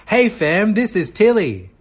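high, loud model for copying; loud demonstration. Shown as a demonstration. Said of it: "Can you say really loudly".

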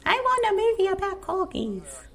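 cutesy gushing. Real, not acted. Gushing about corgis.

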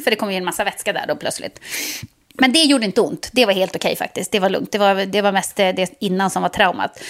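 usual speaking voice

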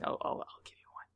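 hushed aside; whispering. Real, not acted. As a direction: whisper it to your cohost. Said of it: "Why are we whispering?".

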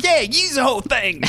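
high mock chef voice; imitating Fergus. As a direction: ham up the eager chef. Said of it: "And he's like".

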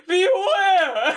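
high, wailing drawn-out cry; theatrical cry of doom; mock warning shout. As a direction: wail it out as a hammed-up warning. Voicing rock face's warning.

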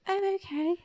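high, small timid creature voice; meek armadillo voice. Retold or imitated.

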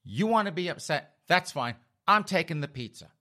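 high-pitched, exasperated dad giving in; fed up. Retold or imitated. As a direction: act out a father who has had enough. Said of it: "fed up".

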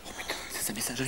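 quick excited whisper; stunned whisper. Acted. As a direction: hiss it fast under your breath. Excited discovery under breath.

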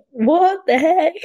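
high, slow stunned exclamation; surprised. Retold or imitated. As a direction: voice the crowd's amazement. Crowd reaction in club.

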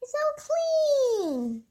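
high, drawn-out delight; delighted cheer. Acted. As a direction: squeal it slowly with delight. Bumblebee delighted by clean library.

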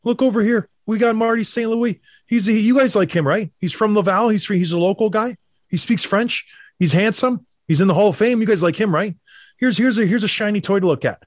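high, hammed-up salesy voice; a team distracting fans with a shiny toy; mocking sales pitch. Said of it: "It's saying".

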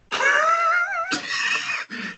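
long high laugh; high laughter. Real, not acted. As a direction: burst out laughing. Laughs at headline.

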